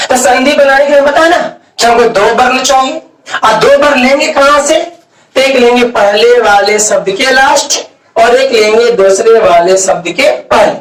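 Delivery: ordinary talk, nothing marked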